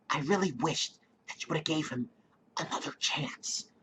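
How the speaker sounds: breathy hushed pleading whisper; Lydia's whisper. Retold or imitated. Imitating Lydia begging the dealers in a whisper. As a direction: whisper it urgently, almost pleading. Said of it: "I like the way she talks".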